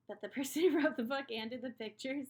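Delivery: embarrassed laugh through words. Real, not acted. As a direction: laugh it off sheepishly. Laughs at unpronounceable name.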